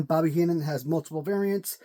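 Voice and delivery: ordinary talk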